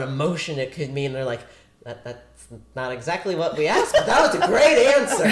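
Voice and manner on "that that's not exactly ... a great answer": retold casting praise, said through laughter; laughing through quote. Retelling the casting panel's reply to the guests, laughing. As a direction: giggle your way through their reply, rising high and loud at the end.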